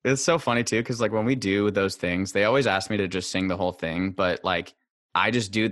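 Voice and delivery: usual speaking voice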